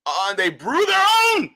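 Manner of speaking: high, loud thrilled shout; excited shout. Real, not acted. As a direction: shout it out, amazed. Thrilled discovery.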